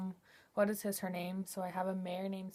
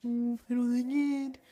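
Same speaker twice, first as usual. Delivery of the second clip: horse voice; 4 semitones higher, 9 dB louder, slower than usual. Pretend. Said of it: "she's like".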